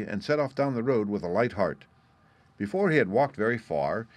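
plain reading voice

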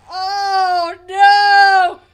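long, shouted oh no; big drawn-out shout; loud dismay. Real, not acted. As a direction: wail it out in alarm. Reacts to the villain's summons.

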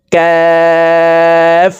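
"কাফ" with the vowel held very long on a high level tone; four-alif stretch. Shown as a demonstration. Teacher demonstrating kaf, long drawn-out hold. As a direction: draw out "কাফ" as one steady chanted note. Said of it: "চার আলিফ টান হবে".

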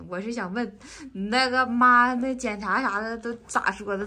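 usual speaking voice

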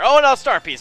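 high, loud delighted oh no; surprised cry. Real, not acted. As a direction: blurt it out at the find. Finds star piece.

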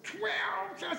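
very high-pitched outraged mock scream. Customer hearing the new tobacco price, mock screaming. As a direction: shriek it high in disbelief, imitating the customer. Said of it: "And he started screaming".

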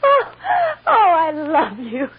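breaking into tearful sobs, slow; crying. Acted. Mother overcome hugging her child.

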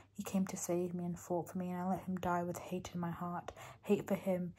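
quiet sad reading; reading aloud. Acted. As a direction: read the confession quietly. Reads quote aloud.

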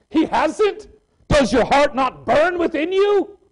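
high, slow, pressing question; a preacher's rising, slowed challenge to the doubter; incredulous challenge. Acted. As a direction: rise up in disbelief and press it home.

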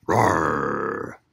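a low, lightly played growling roar; mock roar. Reading the angry page to kids, a low gentle roar. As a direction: play the angry boy's roar, not too scary. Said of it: "I shout a mighty roar".

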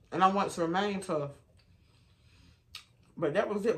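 usual speaking voice

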